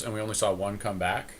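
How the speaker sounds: ordinary talk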